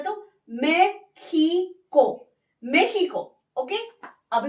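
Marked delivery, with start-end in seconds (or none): 0.6-2.3 drawn-out meh-khi-ko; kh sound. Shown as a demonstration. Teacher repeats Mexico with kh sound slowly. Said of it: "ख का sound निकलता है".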